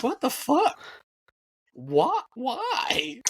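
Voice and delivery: a squeaky, high what the fuck, then a high second why; hammed-up outrage at the haters; mock disbelief. A fan baffled by the people hating a winning team, in a high mock-disbelief voice.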